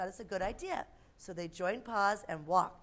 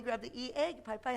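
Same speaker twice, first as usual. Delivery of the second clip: ordinary delivery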